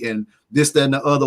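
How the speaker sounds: ordinary talk, nothing marked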